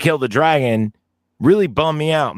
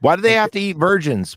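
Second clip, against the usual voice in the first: lightly voiced pleading kid, pitched up; childhood self; 6 semitones higher than usual. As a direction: imitate your younger self protesting. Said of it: "'cause I was a kid".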